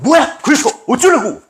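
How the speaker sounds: high, testy flare-up; angry outburst. Acted. An angry person snapping when provoked, high-pitched irritation. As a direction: fire back as if someone provoked you. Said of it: "화가 나는데 누가 건드리면".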